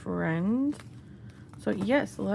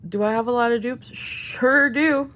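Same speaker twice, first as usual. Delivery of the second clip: rueful self-mockery; 2 semitones higher, 10 dB louder than usual. Real, not acted. Self-mocking about duplicates.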